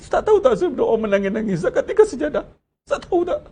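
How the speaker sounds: high, laughing mock voice of a show-off; mock boastful worshipper. Preacher mimicking a worshipper boasting about crying, mocking high voice. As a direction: send up someone bragging about tears in prayer.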